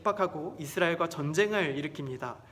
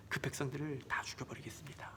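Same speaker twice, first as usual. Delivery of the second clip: hushed, scheming whisper; sinister whisper; 5 semitones lower, 15 dB quieter than usual. Acted. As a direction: whisper the threat like a plotter. Haman's sinister plan.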